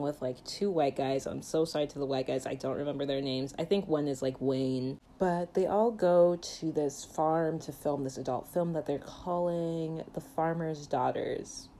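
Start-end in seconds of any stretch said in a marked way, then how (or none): none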